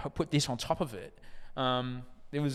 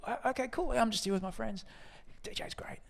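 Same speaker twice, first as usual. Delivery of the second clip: sheepish heckler; 12 semitones higher than usual. Retold or imitated. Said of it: "they go".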